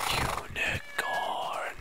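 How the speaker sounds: slow hushed gasp of wonder; awed whisper. Real, not acted. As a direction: breathe the word out slowly, amazed. Spots a unicorn, awestruck.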